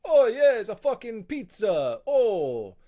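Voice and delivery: loud, high, exaggerated Italian accent; hammed-up Italian accent, shouting; Italian guy impression. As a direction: rave about the pizza like a cartoon Italian. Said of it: "It's like an Italian guy".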